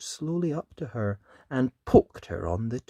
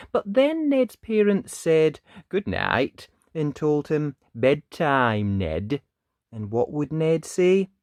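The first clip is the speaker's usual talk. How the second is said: put-on lively read-aloud voice for children; animated picture-book narration; storybook reading; 3 semitones higher, 6 dB louder than usual. A narrator's reading. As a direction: tell it like bedtime story time.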